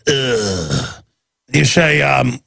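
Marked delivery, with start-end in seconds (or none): 0.1-1.0 low drawn-out dismissive "ugh"; mock a surly clerk's wordless groan; long, falling, apathetic grunt; imitated grunt. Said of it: "the young woman behind the counter goes".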